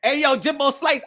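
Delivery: ordinary delivery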